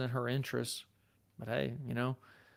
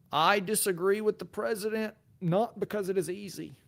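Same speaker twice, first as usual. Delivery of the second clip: put-on higher-pitched Boston accent, speechmaking delivery; JFK; 7 semitones higher than usual. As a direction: declaim it like a Boston-accented president. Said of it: "J F K".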